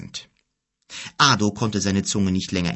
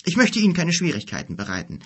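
quick, higher-pitched, mildly downcast; dejected; 9 semitones higher, 4 dB louder, faster than usual. A narrator's reading. Weary guest to his host, dejected. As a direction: apologise with a touch of dejection. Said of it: "sagte er niedergeschlagen".

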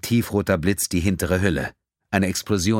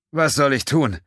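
sarcastic; 8 semitones higher, 4 dB louder than usual. A narrator's reading. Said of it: "fragte Terrell sarkastisch".

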